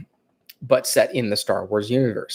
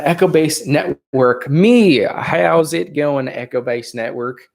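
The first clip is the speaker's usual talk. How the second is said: higher, slow put-on Tennessee accent; fake Tennessee accent; 5 semitones higher, 3 dB louder, slower than usual. Streamer greeting viewers in a fake Tennessee accent. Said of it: "this is my fake Tennessee accent".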